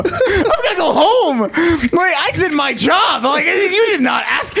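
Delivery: high, laughing indignant rant; laughing protest. Acted. Joking [name] defending going home, high and laughing. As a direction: giggle through a mock complaint.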